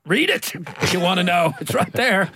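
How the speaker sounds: put-on high, loud, indignant kid outburst; exasperated child's retort. Writer as a small boy snapping at his mother, high exasperated retort.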